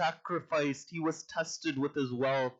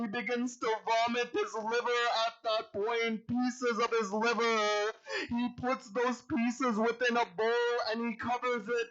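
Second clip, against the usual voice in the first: high, grief-laden preaching voice; sorrowful majlis lamentation, close to sobbing; tearful lament; 6 semitones higher than usual. Real, not acted. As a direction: mourn the Imam, voice pitched high and breaking into weeping. Mourning recitation of the Imam's martyrdom.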